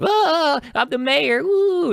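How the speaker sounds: high, loud goofy mayor voice; mock mayor. Mayor clowning to the newcomer, hammed-up high and loud. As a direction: ham up the mayor's silly cheer. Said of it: "she's like".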